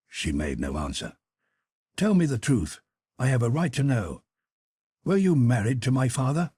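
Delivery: lightly voiced son, demanding; a hint of an enraged son pressing for truth; Jim, cold confrontation. Son confronting his mother about his father, demanding.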